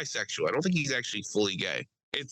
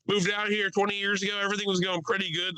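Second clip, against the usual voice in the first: higher, chatty mock local; imitating the guy; 9 semitones higher than usual. Lightly voiced Delco guy telling his story.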